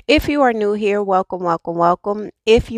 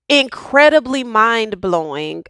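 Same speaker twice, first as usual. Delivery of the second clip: ordinary talk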